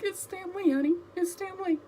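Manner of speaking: high, teary answer to her husband; tearful reply. Retold or imitated. Answering husband who asks if she's okay, high and teary. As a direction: choke it out through tears. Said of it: "I'm like".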